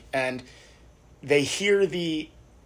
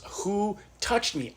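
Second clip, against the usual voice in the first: slow, drawn-out WHO, higher pitched; insistent repeated question; 5 semitones higher, 4 dB quieter, much slower than usual. Acted. Jesus asks the crowd again as nobody admits it, slow and insistent. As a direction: ask it slowly, stretching "Who" and "touched".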